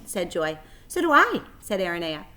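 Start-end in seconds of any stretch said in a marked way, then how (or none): 0.9-1.4 high, loud little pledge; tiny spider voice. Acted. Aranea pledging friendship to Wilbur, tiny and high. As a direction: pipe it up proudly.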